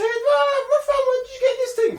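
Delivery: ordinary delivery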